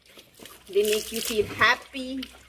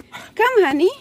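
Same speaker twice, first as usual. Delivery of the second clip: calling the dog; 7 semitones higher, 8 dB louder than usual. Real, not acted.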